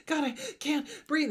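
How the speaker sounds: a panic attack, hammed up and gasping; mock panic. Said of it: "stereotypical panic attack".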